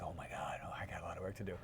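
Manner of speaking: whispered in dismay, voice gone; imitated whisper. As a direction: mutter it under your breath, overwhelmed. Tom overwhelmed by workout.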